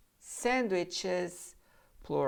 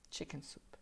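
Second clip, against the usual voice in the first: soft echo; 15 dB quieter than usual. Shown as a demonstration. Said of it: "echo repeat, quieter".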